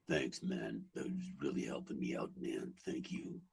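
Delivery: very low, soft rock-star rumble scraped from the bottom of the voice. Retold or imitated. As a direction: rumble it out like a spent rock singer. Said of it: "scraping the bottom off the barrel of the voice".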